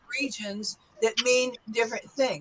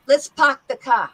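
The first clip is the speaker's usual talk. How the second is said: quicker New York "park the car" without r's; New York accent; 5 dB louder than usual. Shown as a demonstration. Teacher models for students, New York accent. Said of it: "go back to the New York accent".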